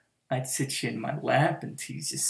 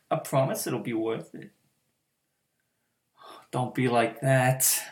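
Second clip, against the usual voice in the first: usual speaking voice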